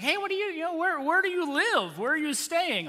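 high-pitched squirrely disciples blurting a question. Acted. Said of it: "they're probably too squirrely to ask".

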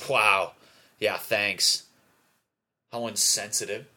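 loud, sarcastic mock thanks; sarcastic mock offense. Ungrateful friend receiving a gift card, sarcastic mock offense. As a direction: play an affronted, ungrateful recipient.

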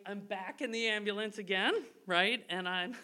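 ordinary delivery

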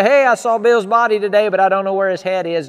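loud, high mock call across the street; mock greeting. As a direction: play a silly townsman calling out. Mock downtown chat.

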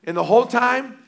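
normal talking voice

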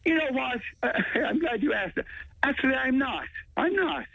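high, laughing through the words; amused laugh. Real, not acted. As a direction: chuckle while answering a surprise question. Caught off guard, laughs it off.

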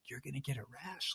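hushed unvoiced whisper. Telling her partner about the rash, in a whisper. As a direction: drop to a whisper as a grim warning.

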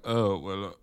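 a light mock transatlantic drawl; smoky old-Hollywood affectation, lightly done; Bradley Cooper's weird voice. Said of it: "doing this whole weird voice".

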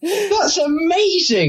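high, slow and laughing; giddy. Real, not acted. To co-hosts about the accent show, slow and laughing. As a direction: savour how great it is.

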